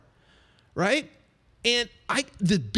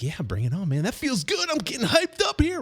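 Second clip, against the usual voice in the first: mock-pumped athlete climbing up in pitch; getting hyped; 7 semitones higher than usual. Receiver answering his agent's pep talk, getting hyped. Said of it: "Rod Tidwell's like".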